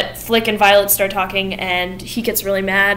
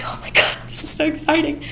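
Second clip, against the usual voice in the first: mock overwhelmed gush; 7 semitones higher than usual. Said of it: "is overcome".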